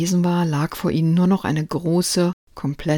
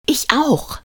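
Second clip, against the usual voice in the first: put-on much higher, eager little boy; crowing boy; 9 semitones higher than usual. A narrator's reading. Lukas crows after Marie, high eager boy. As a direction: squawk it out eagerly. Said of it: "krähte Lukas".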